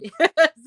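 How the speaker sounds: high, loud little laugh; short laugh. Real, not acted. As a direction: burst briefly into a high laugh. Amused after mentioning bread.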